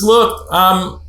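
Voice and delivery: a hint of a guy haggling over chores; mildly voiced husband laying out his deal; bargaining guy. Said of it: "that guy says".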